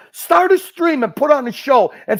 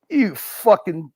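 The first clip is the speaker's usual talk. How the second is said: ordinary delivery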